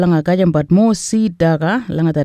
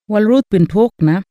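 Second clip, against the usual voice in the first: ordinary delivery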